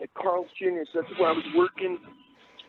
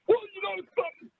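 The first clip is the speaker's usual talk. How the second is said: high, booming ring-announcer induction speech; wrestling announcer; 8 semitones higher than usual. Retold or imitated. Son rocking his dad's wake, high wrestling-promo proclamation. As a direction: proclaim it high, like a championship introduction. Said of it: "I was just this way".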